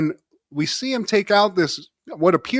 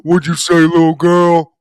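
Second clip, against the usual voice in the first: louder, menacing bully growl; tough guy bully; 10 dB louder than usual. Acted. Big brother intimidating the baby, loud menacing bully. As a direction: loom over the kid and growl. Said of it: "I love how his voice is deep".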